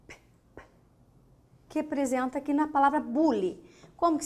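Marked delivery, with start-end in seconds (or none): 0.1-0.6 P, P as voiceless bursts with no vowel; teacher isolating the sound; isolated consonant sound. Shown as a demonstration. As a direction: breathe out the bare consonant. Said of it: "Próximo som".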